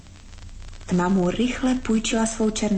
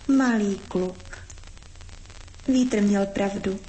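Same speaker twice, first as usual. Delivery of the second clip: ordinary delivery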